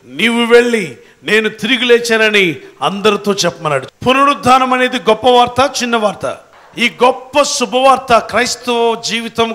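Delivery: loud, fast sermon delivery; impassioned preaching. Real, not acted. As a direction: proclaim it to the congregation, loud and urgent. Preaches the resurrection news.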